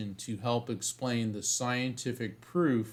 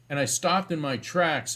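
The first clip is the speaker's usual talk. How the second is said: ordinary delivery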